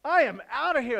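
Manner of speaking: loud, high mock cry of escape; panicking dad. Dad abandoning family as avalanche nears, loud mock panic. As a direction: blurt it out loudly, imitating a fleeing dad. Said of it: "everyone starts panicking".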